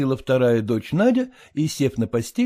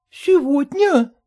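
high, slow startled gasp of a question; high startled wife; alarmed surprise; 5 dB louder, slower than usual. A narrator's reading. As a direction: throw up your hands in alarm. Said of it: "всплеснула руками супруга".

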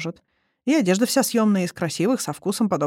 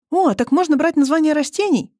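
higher and louder, eager; light up with a new idea; enthused; 10 semitones higher, 5 dB louder than usual. Acted. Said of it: "воодушевилась Алиса". (воодушевлённо, выше и громче)

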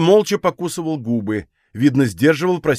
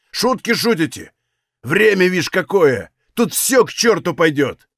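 raised-pitch, heated harangue; exasperated outburst; 3 semitones higher than usual. Acted. Valet berating his hesitant comrades, exasperated and heated. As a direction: scold them in mounting exasperation.

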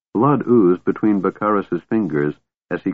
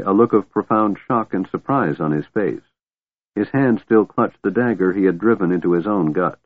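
usual speaking voice

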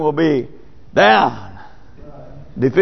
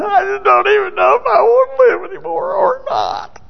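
high mock whimper of despair; a hint of a self-pitying whiner; mock despairing moaner. Preacher mimicking self-pitying churchgoers, high and mock whiny. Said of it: "I hear people just snotting and caring".

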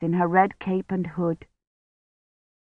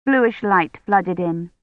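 plain reading voice

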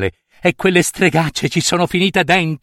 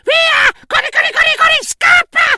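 alto e urlato, di corsa; grida di fuga. Acted. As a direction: urla incitando a scappare. (voce stridula che grida corri)

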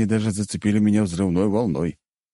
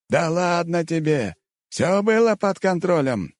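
very high, carefree character voice; breezy brush-off; 11 semitones higher than usual. A narrator's reading. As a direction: wave the scolding away, high and drawn-out. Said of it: "отмахнулся монгол". (беспечно отмахивается высоким голосом)

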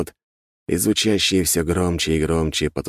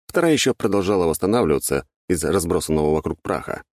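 usual speaking voice

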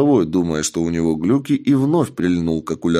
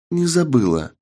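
put-on higher, firm young woman; 6 semitones higher than usual. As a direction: answer it firmly in a higher voice. Partner answers firmly.

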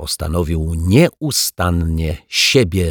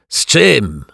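higher, loud bellow to the kitchen; roar; 6 semitones higher, 6 dB louder than usual. A narrator's reading. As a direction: roar the question toward the kitchen. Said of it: "ryknął kelner".

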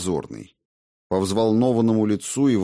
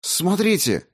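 alarmed cry; 10 semitones higher than usual. Acted. Radko urgently shows the earring.

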